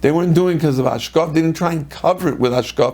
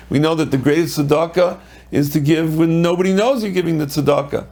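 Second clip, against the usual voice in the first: ordinary delivery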